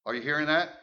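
ordinary talk, nothing marked

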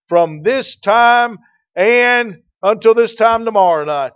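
hammed-up misquote, high and loud; mock the short-sighted reading with a loud flourish; mocking wrong reading. Preacher mocks a wrong reading of the psalm, in a loud hammed-up voice. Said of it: "That's not what he said".

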